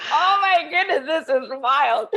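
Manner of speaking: laughing disbelief, high and loud; amazed laughter. Retold or imitated. Finding Elsie is her prayer leader, laughing amazement. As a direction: exclaim it loudly through laughter.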